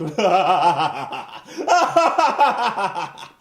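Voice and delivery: loud cartoon villain cackle in two bursts; gloating, hammed-up evil laugh; maniacal villain laugh. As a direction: cackle like a mustache-twirling villain. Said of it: "literally maniacally laughing at the misfortunes".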